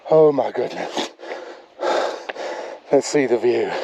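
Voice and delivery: panting near the top; breathless, gasping between words; heavy breathing. Real, not acted. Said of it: "Please excuse my breathing".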